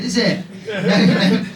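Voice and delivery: ordinary talk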